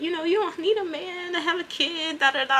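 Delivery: lightly voiced high pleading; imitating Lupe. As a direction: play Lupe talking her into it. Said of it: "she was like".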